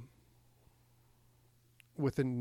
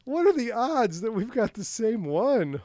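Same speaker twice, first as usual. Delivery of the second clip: very high, slow astonished cry; can't believe the coincidence; shocked disbelief; 11 semitones higher, 7 dB louder, slower than usual. Retold or imitated. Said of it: "and I was like".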